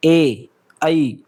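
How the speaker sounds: ए, आई stretched slowly, each vowel held long; drawn-out vowel demo. Shown as a demonstration. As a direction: draw out ए and आई slowly for the class. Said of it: "जैसे बोल के देखो". (ए और आई को धीरे-धीरे खींचकर बोलो)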